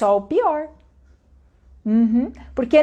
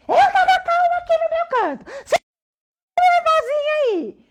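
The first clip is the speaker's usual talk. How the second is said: high-pitched, hammed-up angry outburst; raiva encenada. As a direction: act out yelling back in a quarrel. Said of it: "entrei na raiva junto com a pessoa". (bronca encenada, aguda e exaltada)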